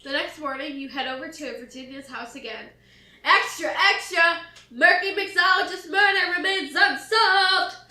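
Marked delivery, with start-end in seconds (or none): 3.2-4.4 loud, high newsboy cry; paperboy yelling. Acted. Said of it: "yells the paperboy". 4.7-7.8 loud, high newsboy call; yelling. Acted. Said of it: "yells the paperboy".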